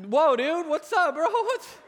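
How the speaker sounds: high, loud startled yelp; comically alarmed, pitch shooting up; creeped out. Retold or imitated. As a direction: act out jolting awake to someone staring at you. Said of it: "I'm, like, totally creeped out".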